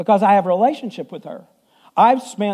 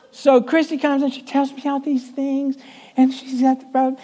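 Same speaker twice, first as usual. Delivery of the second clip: lightly voiced small sweet voice; 6 semitones higher than usual. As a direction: tell it in her little sweet voice. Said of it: "she kinda talked like this".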